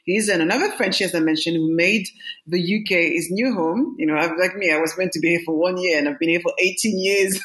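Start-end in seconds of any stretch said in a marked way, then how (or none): none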